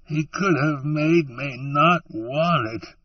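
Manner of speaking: pushing the words out between sobs; through tears. Acted. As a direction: Nort crying to Allie about God's gift. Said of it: "he said through the tears".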